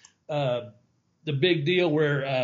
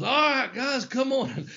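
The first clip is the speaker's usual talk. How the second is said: coach call; 9 semitones higher, slower than usual. Retold or imitated. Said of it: "he goes".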